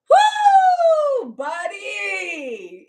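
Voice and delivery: loud, very high holler; long, gleeful cheer; celebratory whoop. Real, not acted. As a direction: whoop with relief and triumph. Celebrating ex facing prison.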